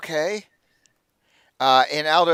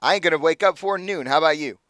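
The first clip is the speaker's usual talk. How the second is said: put-on higher, jovial good-ol'-boy voice; pitched-up friendly NPC, sorry to see him go; Aldo, higher; 6 semitones higher than usual. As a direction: coax him to stay, full of praise.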